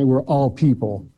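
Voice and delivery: usual speaking voice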